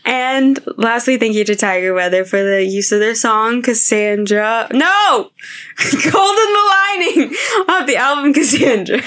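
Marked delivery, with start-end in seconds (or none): 4.7-9.1 giggling through the words; words breaking into laughter; laughing. Real, not acted. Laughs through correcting the song name.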